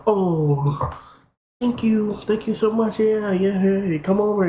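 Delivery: high, sugary thank-you voice; sweet girly voice. Retold or imitated. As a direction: gush like a girl getting free food. Girl thanking for offered food.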